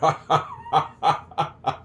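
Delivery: loud, high burst of laughter; hearty laugh. Real, not acted. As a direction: laugh at your own joke. Jokes nobody can talk back.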